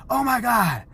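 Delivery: high play-acted exclamation; mock shock. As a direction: fake being horrified, for fun. Dad acts mock shock.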